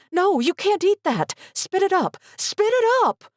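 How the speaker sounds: high frantic pleading; desperate, high and urgent; panicked. A narrator's reading. Mother begging daughter to spit it out, frantic.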